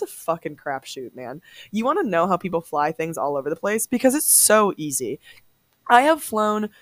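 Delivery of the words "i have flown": ordinary talk, nothing marked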